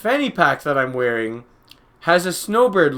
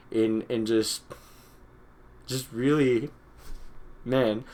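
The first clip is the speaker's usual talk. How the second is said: choked up; 3 semitones lower, 6 dB quieter, slower than usual. Real, not acted. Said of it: "I'm starting to get kind of emotional".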